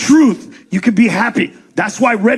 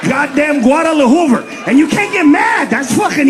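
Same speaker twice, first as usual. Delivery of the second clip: normal talking voice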